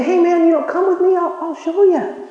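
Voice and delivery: high, light voice of an eager friend; eager invitation. Acted. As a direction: invite him along brightly. Acted friend inviting to church.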